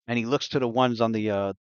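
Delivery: normal talking voice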